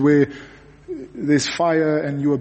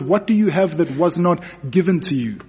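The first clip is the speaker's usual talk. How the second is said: ordinary talk, nothing marked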